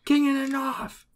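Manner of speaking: put-on high-pitched northern Westerosi accent; high, mock Westerosi accent chant. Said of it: "that was a northern Westerosi accent".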